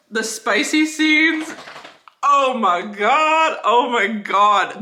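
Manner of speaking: slow, drawn-out gushing; swooning. Real, not acted. Reader swooning over the book's spicy scenes, slow and drawn out.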